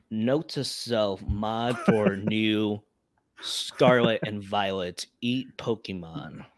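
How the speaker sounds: slow, dropping note to self; slow deadpan dictation. Pretend. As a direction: dictate it like a memo, slowly. Mock note dictated slowly.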